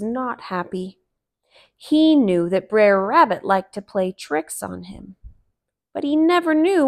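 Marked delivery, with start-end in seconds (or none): none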